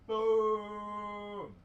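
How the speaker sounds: high, drawn-out boom; 掛け声. Real, not acted. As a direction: stretch it out high like an impact. Countdown to a virtual high five.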